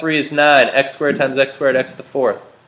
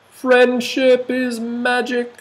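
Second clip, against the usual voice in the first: sing-song catchphrase; 12 semitones higher, slower than usual. Pretend. Teacher quotes cartoon catchphrase.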